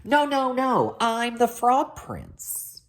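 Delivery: high, drawn-out protest; high exasperated prince; insistent prince. Acted.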